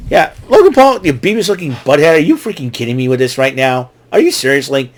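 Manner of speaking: loud, fed-up ranting at a wrestler; exasperated rant. Real, not acted. Host rants at Logan Paul for cheating, loud and exasperated.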